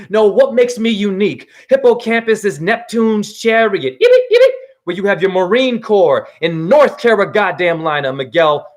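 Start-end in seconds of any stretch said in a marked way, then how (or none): 4.0-4.5 squealed way up high and loud, sarcastic; a shrill sarcastic cheer thrown into the rant; mocking yippee. Ranting vlogger mocks the Truth Movement with a high, loud, sarcastic yippee.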